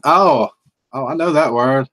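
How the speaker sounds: ordinary talk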